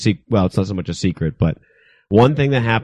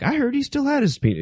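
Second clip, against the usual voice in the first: much higher, mocking gossip voice; mocking rumor voice. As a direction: squeal the rumor like a gossiping fan. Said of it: "people would be like".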